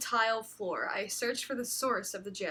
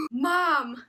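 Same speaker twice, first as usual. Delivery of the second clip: calling out for mom loudly; 4 semitones higher, 6 dB louder than usual. Acted. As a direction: shout up the stairs. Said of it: "I called".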